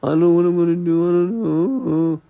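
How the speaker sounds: a whiny, half-sobbing mock voice; mock crying woman. Mocking women who give up and cry for help, in a hammed-up whimper. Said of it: "They wanna be like".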